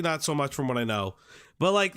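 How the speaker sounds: ordinary talk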